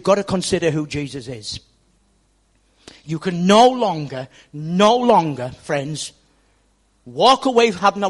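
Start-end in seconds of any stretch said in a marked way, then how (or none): 3.0-5.5 NO punched hard, slowed and loud on "no longer"; emphatic repetition. Acted. Preacher pressing the congregation, emphatic and slow. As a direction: lean on "no" each time, slowly. Preacher hammering home a point.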